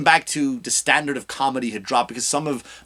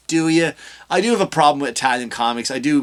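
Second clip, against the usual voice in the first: ordinary talk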